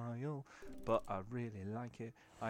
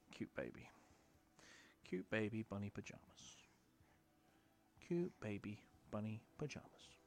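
cooing; 8 dB quieter than usual. Real, not acted. Soft cooing over baby.

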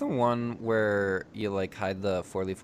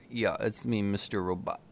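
ordinary talk, nothing marked

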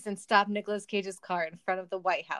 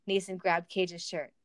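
usual speaking voice

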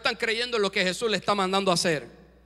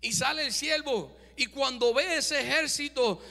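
normal talking voice

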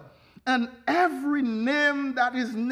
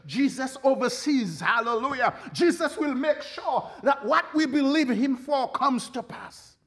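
ordinary talk

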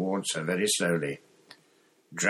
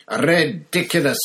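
high, loud shouted spell; determined shout; 7 semitones higher, 7 dB louder than usual. A narrator's reading. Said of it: "he shouted".

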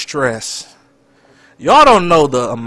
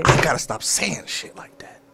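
slow whispered self-reproach; whispered aside; 6 dB quieter, slower than usual. Real, not acted. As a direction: mutter it to yourself, not for her. Muttering regret under his breath.

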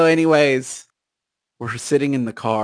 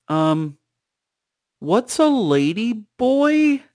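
put-on innocent kid voice; 9 semitones higher, slower than usual. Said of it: "kids just goes".

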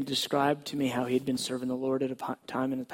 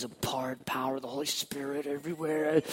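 hushed whisper. Acted. Dramatic hush in testimony.

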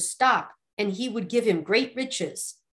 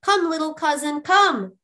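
higher, beckoning call; 6 semitones higher, 4 dB louder than usual. A narrator's reading. Youth beckoning his little cousin over, calling out. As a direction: call him over, rising to a louder last word. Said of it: "he called".

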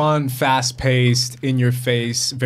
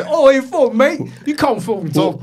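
put-on high, loud, garbled British street accent; mock British slang; 12 semitones higher, 4 dB louder than usual. Host imitating hard-to-follow British films, exaggerated slang accent. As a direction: slur it into barely understandable British slang. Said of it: "British that you can't understand".

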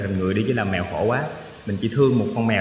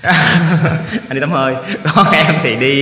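louder, higher, through laughter; laughing tease; 5 semitones higher, 7 dB louder, much slower than usual. Real, not acted. Guest teases host for going to the sauna, laughing. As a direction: tease with a laugh breaking into the words. Teasing host about skipping class.

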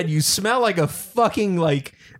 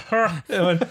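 normal talking voice

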